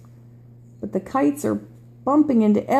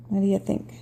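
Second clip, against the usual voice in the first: ordinary delivery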